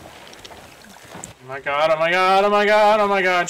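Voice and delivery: rising excited repeats; excited building. Real, not acted. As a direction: build from hushed to thrilled. Excited at spotting shark.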